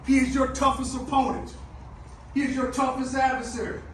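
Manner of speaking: shouting, high and loud; halftime pep speech. Real, not acted. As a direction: preach it at full force.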